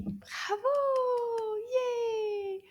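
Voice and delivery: high squealed praise; a puppet squeals with joy; excited puppet cheer. Pretend. Puppet cheering the children's answer.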